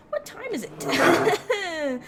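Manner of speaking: slow, high, laughing through the words; laughing awkwardly. Acted. Escaping an uncomfortable talk with the newcomer, laughing. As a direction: laugh off the awkward moment while asking.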